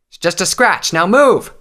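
high, loud officer's shout, peaking on the command; impatient battlefield yell; urgent order. A narrator's reading. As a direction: brusquely urge a hurt soldier onward. Captain urging wounded recruit on mid-battle.

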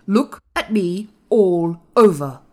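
put-on ringing, indignant young master; commanding. A narrator's reading. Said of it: "he commanded".

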